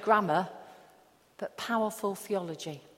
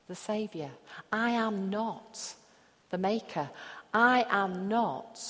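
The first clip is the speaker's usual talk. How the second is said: ordinary talk, nothing marked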